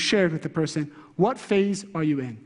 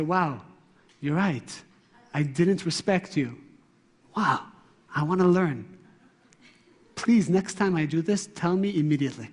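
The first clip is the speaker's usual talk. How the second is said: lower, softened admission; humble, proactive response; 4 semitones lower than usual. Acted. Husband conceding to his wife, humbly receptive. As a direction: own the fault and ask to learn. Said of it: "that would be the proactive way".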